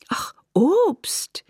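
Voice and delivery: high, breathy gasp of delight. A narrator's reading. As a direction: whisper it high, thrilled. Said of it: "Isabel klang sehr erfreut".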